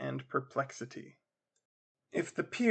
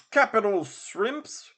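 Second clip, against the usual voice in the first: relishing; 6 semitones higher, 5 dB louder, slower than usual. Acted. Father relishes tea.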